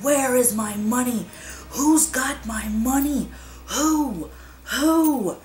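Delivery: high, loud ghost crying out for money; ghostly wail. A narrator's reading. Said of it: "the ghost of the dead woman cried".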